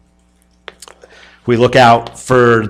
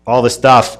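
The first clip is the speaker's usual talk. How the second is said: ordinary delivery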